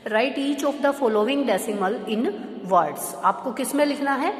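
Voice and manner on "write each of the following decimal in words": reading the question out slowly, word by word; slow read-aloud. Shown as a demonstration. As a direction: read the statement slowly and clearly. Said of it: "सबसे पहले वो read करेंगे". (सवाल धीरे-धीरे पढ़कर सुनाना)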